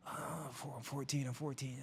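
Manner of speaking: softly groaned answer; wounded boy. Retold or imitated. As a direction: imitate a weak, hurt kid, quietly.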